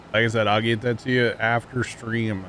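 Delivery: usual speaking voice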